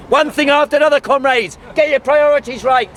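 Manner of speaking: mock stern cosmonaut. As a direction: wave the question away like an official. Cosmonaut scolds the crowd member.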